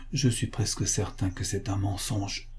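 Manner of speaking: inner thought. A narrator's reading. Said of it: "pensa monsieur Pascal".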